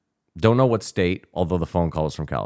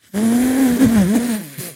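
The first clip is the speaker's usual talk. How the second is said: shrieking laugh, much higher; cracking up at the absurd call; high burst of laughter; 5 dB louder than usual. Real, not acted. Caller asks for Joe Rogan.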